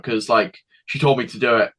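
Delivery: normal talking voice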